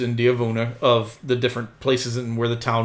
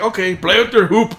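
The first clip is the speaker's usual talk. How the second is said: higher, louder brusque send-off; dismissive driver; 8 semitones higher, 5 dB louder than usual. Acted. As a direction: send the kid away as the driver. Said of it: "and he's like".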